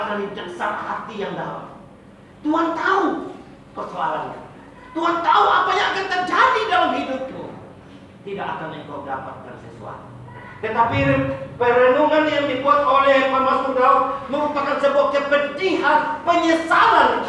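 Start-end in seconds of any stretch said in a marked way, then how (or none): 2.4-7.5 higher, louder preaching cry; preacher rising to full force; fervent preaching. Acted. 10.6-17.3 preacher driving home the point; impassioned preaching. Acted. Preaching at full force.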